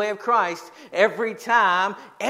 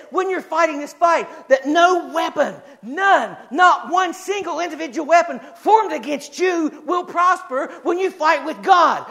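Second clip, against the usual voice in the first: full-force preaching; 7 semitones higher, 4 dB louder than usual. Acted. Pastor proclaiming Christmas joy to his church, at full force. Preaches God's promise of victory.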